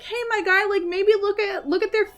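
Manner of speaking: high, loud cartoon animal voice warning the prince; voicing the animals. Animals warning the prince, high and loud. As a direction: squeak it out like a helpful little bird.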